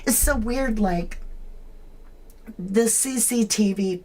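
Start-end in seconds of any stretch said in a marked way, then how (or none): none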